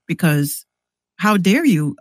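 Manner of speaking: usual speaking voice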